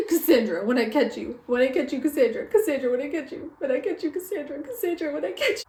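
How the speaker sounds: frantic fan's mock vow of revenge; mock threat. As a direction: repeat it like a playful, rising menace.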